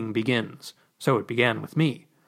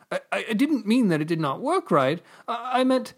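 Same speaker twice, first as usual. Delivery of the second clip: 1 nervous stammer; 7 semitones higher than usual. Acted. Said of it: "looked nervously at the ambassador".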